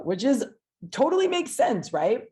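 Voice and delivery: high, loud, totally makes sense; excited. Real, not acted. Excited agreement.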